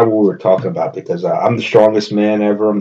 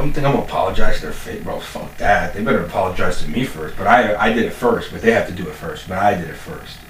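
usual speaking voice

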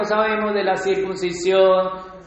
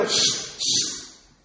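soft unvoiced shushing; hushing; 7 dB quieter than usual. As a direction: shush her quietly. Disciples hushing the woman.

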